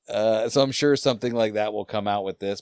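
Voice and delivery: ordinary talk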